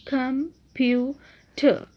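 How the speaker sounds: "Computer" slowly, in three separate beats; syllable by syllable. Shown as a demonstration. Teacher repeating the word for pupils, "computer" slowly divided into syllables. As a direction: break "computer" into com, pu, ter with pauses. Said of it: "इसकी syllable division देखें".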